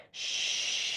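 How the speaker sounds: long soft shh out; long quiet shh breath. Shown as a demonstration. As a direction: hush like shushing a baby. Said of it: "create the sound like you're shushing a baby".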